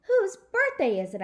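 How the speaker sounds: high, loud excited shout; shouting with delight. Acted. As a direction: burst out thrilled. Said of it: "I shouted, real excited".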